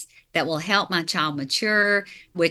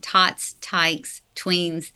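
spaced out, word by word; deliberate, careful list with gaps between words; slow and careful; much slower than usual. Real, not acted. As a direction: say each word separately so the tongue doesn't trip. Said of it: "I have to say that slowly".

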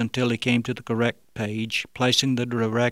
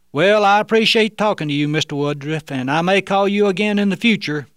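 higher, louder put-on phone voice; disguised voice; 8 semitones higher, 5 dB louder than usual. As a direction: sign off in the fake voice. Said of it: "he continued to use the fake voice".

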